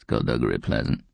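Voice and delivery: put-on low, questioning old growl; deep, aged voice; old frail growl. A narrator's reading. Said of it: "even his growl sounded old and frail".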